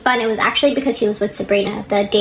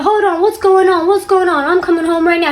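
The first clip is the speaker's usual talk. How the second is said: high, louder, urgent imitation of a husband; mock panicked husband; 8 semitones higher, 5 dB louder than usual. Husband on the phone to his wife, mock panicked. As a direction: act out his alarmed interruption, pitched way up.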